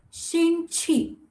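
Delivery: 星期 high and held, syllable by syllable; careful repetition. Shown as a demonstration. Teacher repeats the word for week, careful high repetition. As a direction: model 星期 in a high, even pitch. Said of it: "I repeat again".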